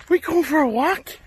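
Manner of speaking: chirpy pet voice; talking to a dog. Real, not acted.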